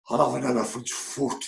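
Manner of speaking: a hint of Kel's northeast accent, ordering coffee. Kel ordering his coffee, light northeast accent imitation. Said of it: "in that accent how he speaks".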